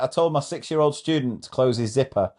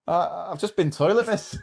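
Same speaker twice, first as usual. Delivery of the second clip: put-on child's voice; 7 semitones higher than usual. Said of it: "the child said".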